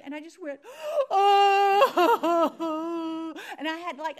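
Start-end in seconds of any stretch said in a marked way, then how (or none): 1.1-3.5 loud, high imitated bawling; gasp, then burst into a big hammed-up wail; exaggerated sobbing outburst, imitated; mock ugly cry. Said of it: "a big old ugly cry".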